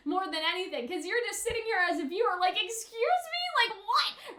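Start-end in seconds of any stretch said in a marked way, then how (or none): 2.6-4.4 mock disbelief. Viewer reacting in disbelief.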